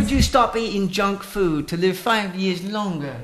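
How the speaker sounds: high English comic impression; Russell Brand. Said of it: "I'll read it as Russell Brand".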